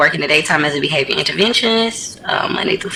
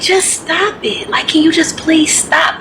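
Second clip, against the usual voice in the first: high, slow, overly nice plea; nicely, pleading; 10 semitones higher, slower than usual. Retold or imitated. Aide pleading nicely with the girl, high and slow. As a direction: stretch it out high and sweet. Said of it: "I said it to her nicely".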